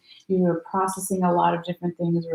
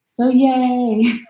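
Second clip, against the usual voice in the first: a bright, higher, louder little cheer; happy cheer; 6 semitones higher, 6 dB louder than usual. Real, not acted. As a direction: celebrate the good news out loud. Cheering good news for sign.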